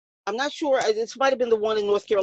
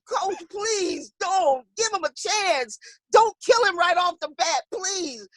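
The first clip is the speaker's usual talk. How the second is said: slow, higher mock pleading; pleading boxers; 6 semitones higher, slower than usual. Boxers begging coach not to throw towel, mock plea. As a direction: beg the coach, hands on his arm.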